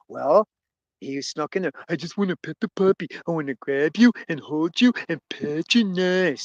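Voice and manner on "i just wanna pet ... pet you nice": put-on big simpleton cartoon voice; slow-witted gentle giant. Big simpleton longing to pet the puppy, oafish voice. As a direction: coo it like an oafish puppy lover. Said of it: "See, that's a stereotype".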